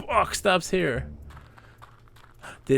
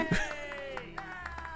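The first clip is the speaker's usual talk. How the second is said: high laugh; 14 dB quieter than usual. Real, not acted. Laughing after Tatooine pun.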